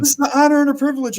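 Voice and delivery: fast, high imitated gushing; mock-thrilled fan, high and rushed; starstruck fan. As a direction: gush it loudly like an overawed fan.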